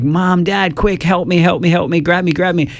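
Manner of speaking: put-on high, frantic little kid calling for help; scared child. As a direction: play the panicking kid on the monkey bars. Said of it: "they're like".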